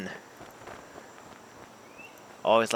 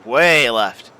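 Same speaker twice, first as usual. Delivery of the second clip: exclaiming; 3 semitones higher, 10 dB louder than usual. Real, not acted. Ball veering off.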